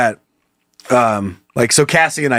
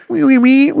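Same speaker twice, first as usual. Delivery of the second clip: squeaky tinny can voice; 10 semitones higher, 3 dB louder than usual. Retold or imitated. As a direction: sound like a voice through a tin can. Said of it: "And it's that tinny voice".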